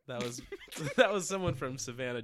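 normal talking voice

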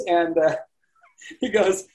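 ordinary talk

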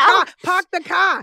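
slow, loud Boston accent with no R's. Shown as a demonstration. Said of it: "Boston accent".

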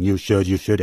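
low, wagging-finger scold; deep scolding voice. Retold or imitated. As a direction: scold like a stern inner critic. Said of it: "that deep voice and that big finger".

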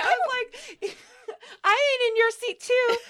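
high, loud and laughing almost to tears; laughing so hard it cracks. Real, not acted. Laughing at her own story to a friend, near tears. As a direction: crack up halfway through the line. Laughing while retelling the stranger's voice.

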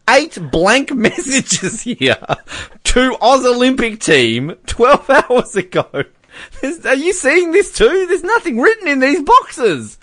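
high-pitched, incredulous outburst; amused disbelief. Real, not acted. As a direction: voice climbing high in baffled amusement. Baffled by blank published messages.

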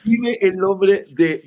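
urgent. Retold or imitated. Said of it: "así todo nervioso".

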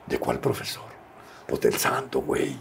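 low, soft aside in quoted dialogue; retold hushed exchange. Two wrestlers talking about whose son he is, hushed. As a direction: drop the voice right down, as if confiding.

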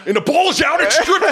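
high, loud, laughing fumble call; mock play-by-play. Host mocking the announcer calling a fumble, high excited call. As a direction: call the strip like a radio announcer.

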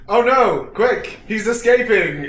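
hammed-up urgent warning, drawn out; mock alarm. Player pretending to warn a soldier of escape, mock alarm. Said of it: "I look at him, I say".

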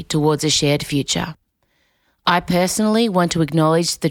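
measured, solemn formal read; formal acknowledgment. Real, not acted. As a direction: read a respectful statement, evenly and deliberately. Reads an acknowledgment of country.

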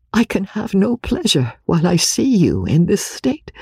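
grieved Eleanor. Acted. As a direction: reason with her sorrowfully.

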